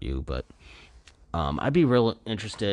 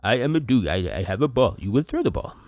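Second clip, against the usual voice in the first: much higher, silly dog voice; goofy dog voice; 5 semitones higher than usual. Retold or imitated. Voicing his dog to podcast listeners, goofy high dog voice. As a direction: voice the dog like a dopey pup. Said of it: "The freaking dog, he's like".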